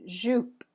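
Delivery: Jupe with a French zh and rounded u; French word jupe. Shown as a demonstration. Teacher models French jupe, clear zh. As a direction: say Jupe as zhoop, crisp. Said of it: "First, I have jupe".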